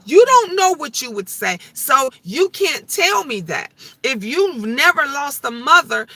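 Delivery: usual speaking voice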